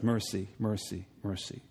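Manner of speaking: soft, low muttered mercy; quiet pleading under the breath. Shown as a demonstration. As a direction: murmur it to yourself, barely voiced. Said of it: "underneath my breath".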